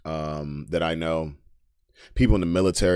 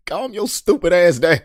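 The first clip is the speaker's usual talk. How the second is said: higher, laughing through the words; 7 semitones higher than usual. Real, not acted. Laughing at a hothead on stream. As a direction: laugh while telling them to calm down.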